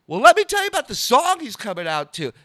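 high, loud host patter; loud stage announcement. Retold or imitated. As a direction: imitate pitching a bit to a whole theater. Retold stage announcement to crowd.

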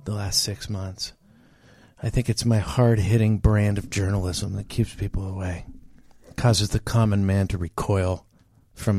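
understated nature-show host; hushed host narration. Acted. Low hushed host monologue.

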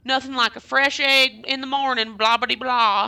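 high, loud Southern country twang; perky exaggerated country voice; country accent. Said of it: "thick country accent".

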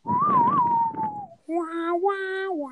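high, drawn-out "wah, wah, wah"; cartoonish high whine stepping down; mock wailing noise. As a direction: imitate a long high wail sliding downward. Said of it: "all I hear is".

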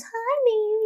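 high, soft cooing; baby talk to dog. Retold or imitated. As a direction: coo it to the bulldog. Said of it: "And she goes".

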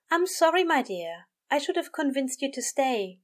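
high, gentle apology; apologetic angel. A narrator's reading. Aziraphale apologises to his dear Crowley, gently. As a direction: lift the pitch, fond and sorry.

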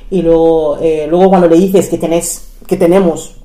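ordinary delivery

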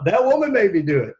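hammed-up blame on woman; mock blaming whine. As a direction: repeat the excuse with mock emphasis. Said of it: "there's the one we could use".

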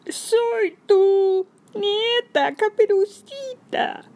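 wolf squeezing out a tiny voice; wolf's thin fake voice. A narrator's reading. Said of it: "tratando de hacer la voz más finita que podía".

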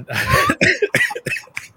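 squealing high laugh; high shriek of laughter. Real, not acted. Laughing at joke.

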